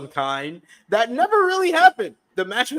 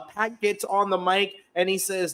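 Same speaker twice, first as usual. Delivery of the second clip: normal talking voice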